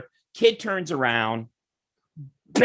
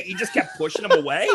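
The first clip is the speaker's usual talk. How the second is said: ordinary talk, nothing marked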